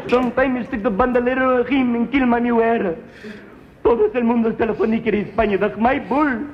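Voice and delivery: put-on high, fast, pleading kid voice in a heavy Spanish accent; a begging boy with a thick accent; Mexican boy pleading. Boy begging his father to spare the bull, high and pleading.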